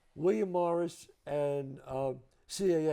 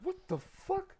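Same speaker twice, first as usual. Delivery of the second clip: stunned disbelief; 5 dB quieter, slower than usual. Retold or imitated. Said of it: "I said".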